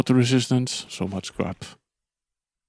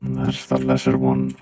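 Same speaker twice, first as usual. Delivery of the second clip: deep pitched-down robot voice; 8 semitones lower than usual. Acted. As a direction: talk like a voice-changed robot. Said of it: "Did you not like the robot voice".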